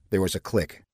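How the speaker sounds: plain reading voice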